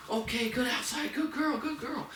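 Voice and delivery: very high, gushing puppy praise; super positive. Shown as a demonstration. Trainer praising dog for going outside, super positive high praise. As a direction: squeal happy praise at the dog. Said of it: "be super positive".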